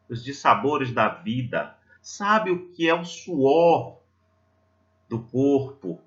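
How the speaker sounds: ordinary talk, nothing marked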